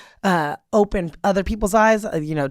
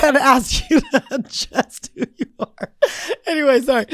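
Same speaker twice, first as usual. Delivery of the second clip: higher voice broken up by real laughter; giggling; 6 semitones higher than usual. Real, not acted. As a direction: try to finish the sentence while giggling. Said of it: "I gotta giggle out of that".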